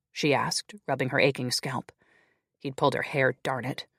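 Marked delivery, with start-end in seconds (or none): none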